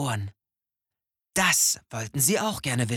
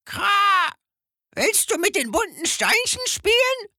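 much higher, loud croaking bird voice; cawing raven; 5 dB louder than usual. A narrator's reading. Raven invites the owl to play, high loud caw. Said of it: "ein Rabe ... fragte".

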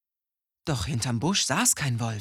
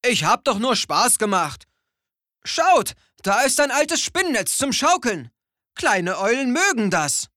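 higher, louder sheepish excuse; embarrassed beetle; 10 semitones higher, 6 dB louder than usual. A narrator's reading. Ashamed beetle offering the owl a swing, sheepishly loud. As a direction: cover up with forced cheer. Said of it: "schämte sich und sagte".

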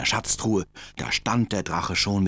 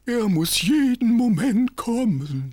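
wizard voice; 5 dB louder, slower than usual. Acted.

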